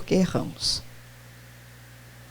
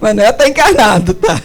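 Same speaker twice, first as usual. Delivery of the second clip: quick words through a laugh; real laughter in the voice; amused, laughing; 4 dB louder than usual. Real, not acted. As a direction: say it while chuckling. Joking with audience, laughing.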